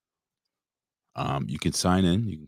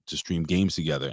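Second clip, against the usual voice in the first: usual speaking voice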